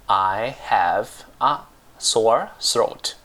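"I have a sore throat" drawn out slowly with gaps between words; slow word-by-word model. Shown as a demonstration. Teacher gives learners the English for a painful throat, slow word-by-word "I have a sore throat".

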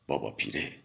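آزرده و آهسته; hurt, low and soft. A narrator's reading. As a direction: grumble the name under your breath, offended. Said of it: "با خاطری آزرده گفتم".

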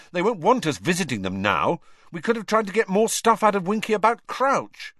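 high, irritated complaint; angry. A narrator's reading. Ron complains they won't be welcome now, angrily. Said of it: "said Ron angrily".

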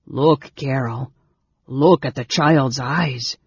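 slow, amazed urging; awed. A narrator's reading. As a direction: urge a friend to look, in wonder. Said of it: "the stranger gasped in awe".

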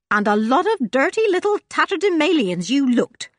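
put-on a fond cook tutting at grubby children; Joanna, housekeeper voice. A narrator's reading. Housekeeper Joanna teasing the grubby children, lightly scolding.

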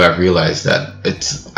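ordinary talk

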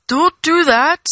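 alarmed warning. Acted. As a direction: forbid it urgently, drawing out each word. Wizard sharply warns Frodo off.

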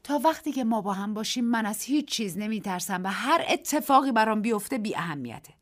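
ordinary delivery

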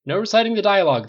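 high, loud teasing warning; mock scolding. A narrator's reading. Bruce teasing Tony with a warning, high and loud. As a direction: wag a finger at him playfully.

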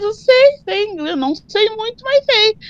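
put-on answering the girl who doubted her English, high and proud; proud retort. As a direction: assert it proudly, chin up. Proudly answers she knows English.

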